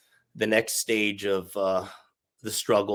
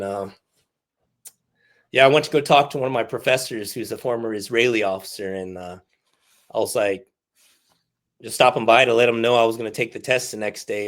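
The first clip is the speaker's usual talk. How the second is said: ordinary talk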